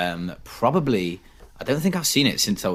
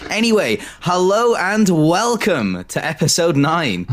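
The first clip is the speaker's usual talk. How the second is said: higher-pitched, drawn-out show opening; podcast intro; 7 semitones higher, slower than usual. Acted. Host welcoming listeners to episode nine, announcer style.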